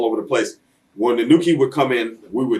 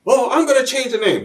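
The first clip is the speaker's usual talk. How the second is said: much higher defensive excuse voice; mock Vince. Wrestler mocking Vince's excuse, high innocent voice. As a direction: pitch it way up, playing innocent. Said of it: "He says".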